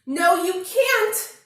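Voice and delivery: very loud, slow yelp of protest. A narrator's reading. Bear cub stopping the bird taking his hat, very loud yelp. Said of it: "yelped Douglas".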